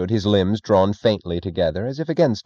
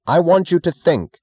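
demanding shout; 10 semitones higher, 5 dB louder than usual. A narrator's reading. Thompson pressing Galt, demanding.

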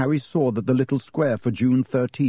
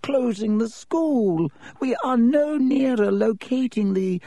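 put-on old headmaster voice; 10 semitones higher than usual. A narrator's reading. Headmaster Dippet speaks.

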